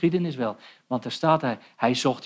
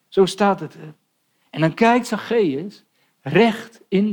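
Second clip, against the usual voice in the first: fervent preaching; 4 semitones higher, 5 dB louder than usual. Acted. Preacher builds to full force.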